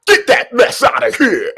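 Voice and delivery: high, loud mixtape hype voice. Pretend. Streamer doing his mixtape voice after a block. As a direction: announce it like a mixtape drop. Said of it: "I gotta do my mixtape voice".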